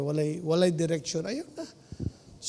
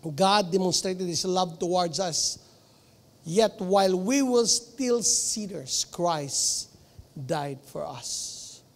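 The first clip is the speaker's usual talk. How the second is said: scripture reading; much slower than usual. Acted. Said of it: "Romans five eight".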